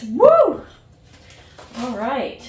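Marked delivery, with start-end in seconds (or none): 0.0-0.6 high, loud woo; excited whoop. Real, not acted. Vlogger kicking off the box opening, loud excited whoop. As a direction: let out a big happy whoop.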